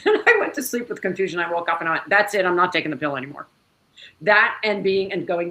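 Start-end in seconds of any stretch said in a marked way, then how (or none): 2.1-3.4 firm declaration. Retold or imitated. Her decision on waking up.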